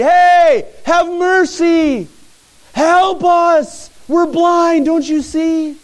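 high, loud, drawn-out yell; yelling for mercy. Retold or imitated. Blind men yelling after Jesus in the street, high and loud. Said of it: "They start yelling behind him in the street".

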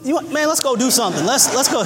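eager shout-out to the friend who saved his family; excited greeting. Pretend. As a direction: call out to him overjoyed, voice way up. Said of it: "I'm gonna say".

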